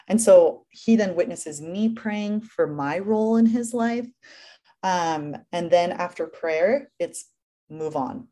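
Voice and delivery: ordinary talk, nothing marked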